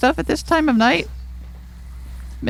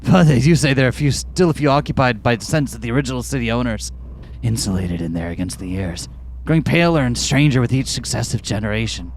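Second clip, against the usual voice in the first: low, ominous character voice; deep storyteller voice; 14 semitones lower, 4 dB quieter than usual. Acted. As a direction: tell it low and eerie. Deep character voice telling lore.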